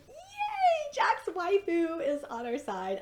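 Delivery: loud, high, drawn-out cheer; excited cheer. Retold or imitated. Fan cheering Jack's waifu joining their side, overjoyed. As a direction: let out a fangirl yay and ride it high. Retelling own cheer at the episode.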